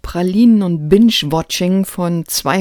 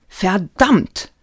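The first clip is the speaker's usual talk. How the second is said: mild curse; 3 semitones higher, 3 dB louder than usual. Acted. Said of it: "rief Mollenkott".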